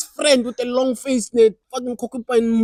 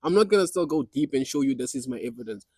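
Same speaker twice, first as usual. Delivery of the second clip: normal talking voice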